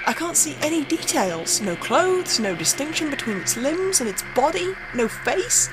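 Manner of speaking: spooked narrator, voice tightening; rising dread. Acted. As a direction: let the fear creep up as you describe it. Describing the figure on video.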